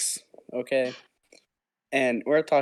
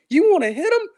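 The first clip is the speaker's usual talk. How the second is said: very high, louder mocking teacher voice; egging on a fight; 6 dB louder than usual. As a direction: taunt him up high to go fight. Teacher urging fight, retold.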